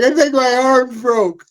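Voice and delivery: high, slow pained whine; hurt guy's whimper. Retold or imitated. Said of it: "the guy's like".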